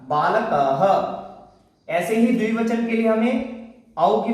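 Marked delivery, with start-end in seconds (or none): 0.1-1.9 बालकः drawn out very slowly, ending in an echoing h; slow visarga demo. Shown as a demonstration. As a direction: spell out बालकः syllable by syllable, closing with a clear h. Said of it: "तो क्या हो जाएगा पूरा". (बालकः को बहुत धीरे, अंत में स्पष्ट ह के साथ)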